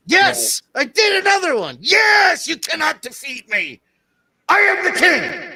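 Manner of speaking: triumphant villain shout, high and loud; booming echoing villain monologue. Pretend. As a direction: gloat like a conquering villain king. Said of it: "You're having too much fun with that".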